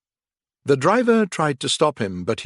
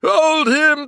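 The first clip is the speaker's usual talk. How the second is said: shouting; 8 dB louder than usual. A narrator's reading. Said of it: "they cried".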